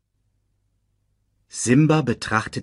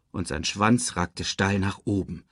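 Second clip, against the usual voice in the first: plain reading voice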